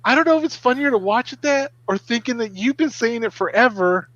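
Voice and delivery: put-on high-pitched kid's voice; imitating amused daughters. Daughters reacting to the film clips, imitated amazement. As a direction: lift it high, playing the girls. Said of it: "and they're like".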